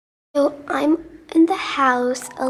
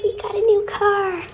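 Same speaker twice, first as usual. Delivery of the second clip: higher, bright big-news reveal; excited announcement; 6 semitones higher, faster than usual. Real, not acted. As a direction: announce it with glee. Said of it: "so yay".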